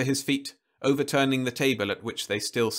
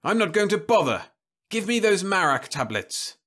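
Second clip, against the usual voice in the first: savage demand; 9 semitones higher, 4 dB louder than usual. Acted. Husband demands the drug.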